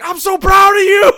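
hammed-up gushing parent; proud father. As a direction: coach gushing like a proud dad. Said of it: "like he was a proud father".

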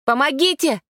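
high desperate shout; scream for help. A narrator's reading. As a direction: yell for help in panic. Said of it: "закричал мальчик". (отчаянный крик о помощи)

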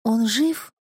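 high-pitched urgent question. A narrator's reading. Girl runs after father carrying the youth, urgent question. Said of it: "спрашивая".

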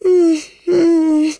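high, pinched grumbling hum; a sceptical old lady's mm-mm; doubtful mumble. Acted. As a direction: mumble your doubt through closed lips. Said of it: "borbottò Madame de Villeparisis".

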